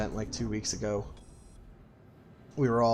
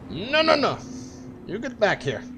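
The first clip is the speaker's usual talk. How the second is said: high, loud shrieking; excited shout; 10 dB louder, slower than usual. Real, not acted. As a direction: scream it out at the enemy. Screaming during dogfight.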